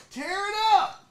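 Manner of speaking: high shout to fire himself up; hype shout. Real, not acted. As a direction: yell it like a battle cry. Hypes himself up.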